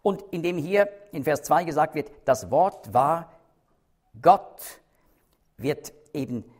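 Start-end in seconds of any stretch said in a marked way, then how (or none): 2.2-4.8 slow "Das Wort war", pause, then "Gott" much louder on a rise; deliberate, weighty "Gott" at the end; stress on Gott. Shown as a demonstration. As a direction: build the phrase and punch "Gott".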